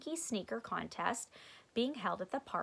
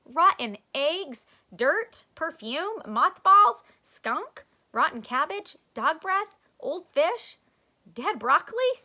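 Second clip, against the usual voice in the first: high, loud rapid-fire guesses; excited listing; 7 semitones higher, 11 dB louder than usual. Acted. Stink guessing smells at the station, excited and loud. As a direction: rattle off each smell with glee.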